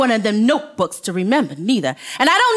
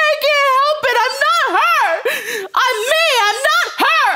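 much higher, near tears, pleading; hurt woman begging him to stop; tearful plea; 11 semitones higher than usual. Acted. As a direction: beg to be left alone. Breaks down after being yelled.

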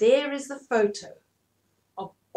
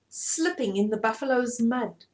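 usual speaking voice

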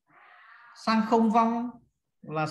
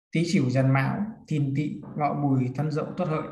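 the list strung together without long pauses; run-through recitation; 3 semitones lower, faster than usual. Shown as a demonstration. Teacher recapping the fixed order for students, run together. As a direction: recite the whole sequence in one connected run.

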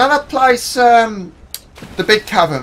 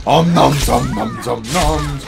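mock munching; 2 semitones lower, slower than usual. Pretending to eat prey.

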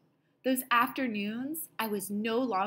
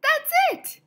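very high, loud shout of a name; child crying out to a friend; excited cry; 9 dB louder than usual. Acted. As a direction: yell the name in sudden discovery. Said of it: "I cried".

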